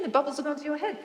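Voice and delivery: ordinary talk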